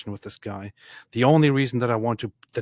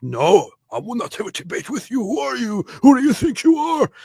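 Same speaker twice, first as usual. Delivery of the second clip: high-pitched outraged imitation, growing louder; indignant mock refusal; 12 semitones higher, 6 dB louder than usual. Retelling the opponent refusing a debate, in mock outrage. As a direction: build to an offended, high outburst.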